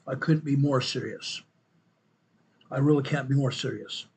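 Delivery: ordinary delivery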